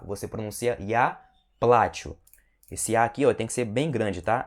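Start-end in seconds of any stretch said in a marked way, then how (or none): 0.8-2.2 плачу said PLA-chu, first syllable punched; stress on the A. Shown as a demonstration. Teacher repeats the correct form, stressed A in плачу. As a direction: put the weight on the A in плачу. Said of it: "sílaba tônica vai aqui no A".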